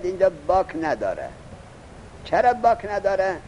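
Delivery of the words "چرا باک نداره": ordinary delivery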